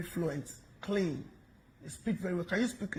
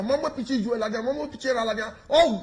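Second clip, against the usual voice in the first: usual speaking voice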